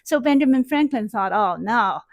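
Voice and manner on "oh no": usual speaking voice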